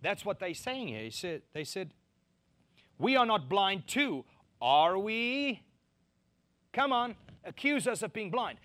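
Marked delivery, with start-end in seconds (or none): 3.0-8.6 louder, higher, defiant push-back; indignant challenge. Shown as a demonstration. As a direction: throw it back as an accusation. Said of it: "you can imagine what they said".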